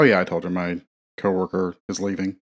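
ordinary delivery

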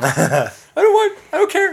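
high, loud joking yell; mock shout. Telling his wife to shut up, high mock shout. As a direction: blow up at the wife for fun. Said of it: "I was like".

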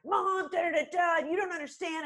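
high-pitched mock teen protest; hammed-up defensive teen outburst; defensive teenager. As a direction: whine back at mom, talking over her in nonsense syllables. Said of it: "you get all defensive".

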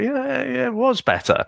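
convince yourself it was good; forced approval. Retold or imitated. Fan after Attack of the Clones, high half-hearted approval. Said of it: "I thought".

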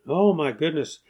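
normal talking voice

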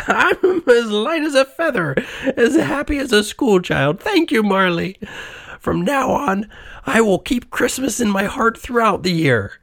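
high-pitched elated character voice; joyful Scrooge. A narrator's reading. Old miser rejoices on waking in his bed, high elated voice. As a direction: overflow with relief, pitched high throughout.

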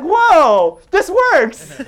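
high, loud laughing amazement; delighted disbelief, breaking into a laugh; amazed whoa. Retold or imitated. Telling the church how the Harvard shirt worked, amazed and laughing.

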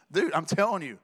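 ordinary talk, nothing marked

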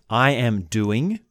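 each koan slow and deliberate; measured, unhurried recitation; slow demo reading. Shown as a demonstration. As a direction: lay out every phrase slowly with pauses. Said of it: "So look at these koans".

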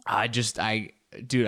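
usual speaking voice

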